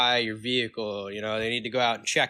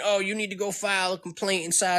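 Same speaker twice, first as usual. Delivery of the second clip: a higher, dismissive brush-off from the clerk; retold attitude; 6 semitones higher than usual. Mimicking a DMV clerk who brushes off a complaint with attitude. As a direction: toss the line off like a clerk giving attitude. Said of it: "some attitude saying".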